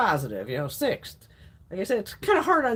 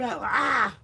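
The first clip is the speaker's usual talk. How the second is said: short higher-pitched "ah"; mock disgusted cry; 4 semitones higher than usual. Woman picking up the dead thing, mock disgusted cry. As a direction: recoil with a mock squeal. Reaction to dead squashed bug.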